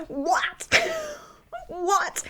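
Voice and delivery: high disbelieving what with a laugh; high, baffled, laughing what; incredulous. Real, not acted. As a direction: react in stunned disbelief. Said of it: "disbelief at couple's excuse".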